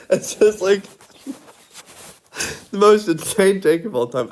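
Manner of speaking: high-pitched, giggling delivery; laughter spilling into the sentence; laughing. Real, not acted. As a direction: crack up while getting the words out. Cracks up at own joke.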